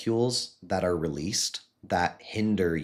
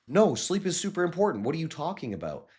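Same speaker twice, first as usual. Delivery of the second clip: high-pitched, indignant objection, acted out; incredulous protest; 9 semitones higher than usual. People arguing past each other about sleep, incredulous. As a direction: play a baffled arguer, pitched way up.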